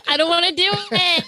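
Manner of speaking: high, whiny refusal; childish whine. Retold or imitated. Scared kid refusing God's calling, high whine.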